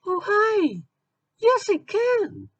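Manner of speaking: put-on very high squeaky girl voice; Supergirl. Naive Supergirl answers strangers in park, squeaky and sweet. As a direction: naive heroine in a tiny falsetto.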